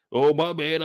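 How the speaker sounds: normal talking voice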